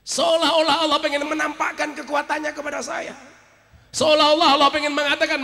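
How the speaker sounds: high, loud sermon delivery; ringing preacher's build-up; full-force preaching. Real, not acted. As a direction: lift it up and project to the congregation.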